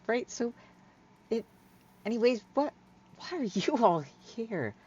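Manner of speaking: awkwardly change the subject; nervous Hyla. Acted. Nervous shopper asking friends why they're here, flustered.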